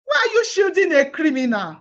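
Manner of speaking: ordinary talk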